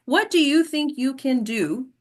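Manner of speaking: usual speaking voice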